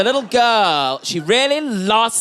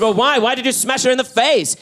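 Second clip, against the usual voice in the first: higher-pitched mock indignation; demanding mum; 7 semitones higher than usual. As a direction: demand an explanation like an appalled mum. Mum confronting son, acted.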